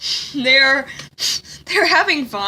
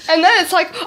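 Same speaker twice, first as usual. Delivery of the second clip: usual speaking voice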